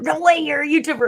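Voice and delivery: high, loud amazed No way; excited disbelief. Retold or imitated. Teller learning she's a YouTuber, amazed. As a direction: sound floored and thrilled. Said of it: "he says".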